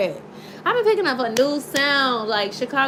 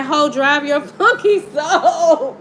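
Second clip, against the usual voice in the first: laughing imitation; 2 semitones higher, 4 dB louder, slower than usual. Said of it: "ooh, he said".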